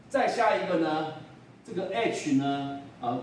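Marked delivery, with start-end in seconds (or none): none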